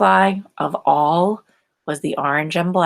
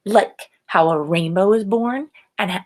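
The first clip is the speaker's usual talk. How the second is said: ordinary delivery, nothing marked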